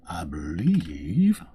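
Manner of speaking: very slow, stretched out; stalling over the next quest, drawing it out; drawn out. Shown as a demonstration.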